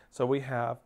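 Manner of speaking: normal talking voice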